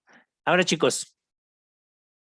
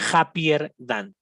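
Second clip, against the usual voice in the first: happier than, each syllable drawn out slowly; slow model reading; 4 dB louder, much slower than usual. Shown as a demonstration. Teacher slowly models happier than for the class. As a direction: say it slowly so students copy. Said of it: "teacher models the comparative".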